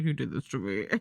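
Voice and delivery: a hint of a grumpy mother blaming you; mock annoyed mom. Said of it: "she's like".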